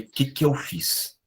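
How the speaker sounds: slow, soft, stunned self-question; dismayed self-question. Retold or imitated. After snapping at the maid, asking himself softly and slowly. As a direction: let it hang as if shocked at himself. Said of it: "naquele momento eu falei".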